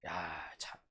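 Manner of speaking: normal talking voice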